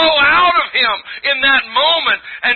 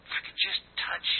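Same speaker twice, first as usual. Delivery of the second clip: whisper; 4 semitones lower, 16 dB quieter than usual. Acted. Woman's secret inner hope.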